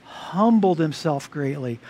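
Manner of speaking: "Humbled himself greatly." धीमे, नरम, भारी ज़ोर के साथ; slow, soft, weighty "Humbled"; stress on humbled. Shown as a demonstration. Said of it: "something hugely important".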